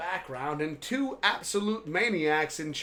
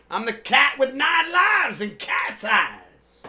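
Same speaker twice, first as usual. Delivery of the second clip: slow boastful shout; 5 semitones higher, 7 dB louder, slower than usual. Acted. Boastful proclamation.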